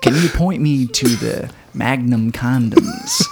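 slow, smooth Slater imitation. Friend joking about the grocery store, slow and smooth. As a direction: lay the line on slow and cool.

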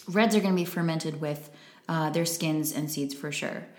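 ordinary talk, nothing marked

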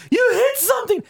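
put-on high panicked shout from the girlfriend. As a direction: yell it in a sudden panic. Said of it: "girlfriend at that time panicking".

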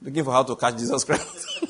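normal talking voice

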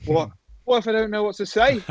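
high-pitched mock panic; retold nerves. Presenter fretting to himself before live TV, retold nerves. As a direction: imitate a jolt of stage fright. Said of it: "I was terrified".

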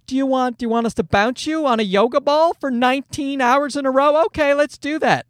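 put-on high-pitched placating baby talk; anxious doting parent. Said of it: "they're all so paranoid".

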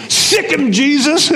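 sic the dog on him; shouted command. Acted. Parrot commands the pit bull.

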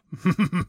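imitated private giggle, building; hammed-up self-amused giggling; giggling to himself. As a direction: act out a villain's solitary chuckling fit. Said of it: "starts giggling to himself".

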